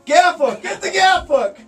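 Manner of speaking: high, loud mock-frantic yell; urgent acted shouting. Spearer calling to the crew for the gaff, urgent mock shouting. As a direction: holler for the tool in staged panic.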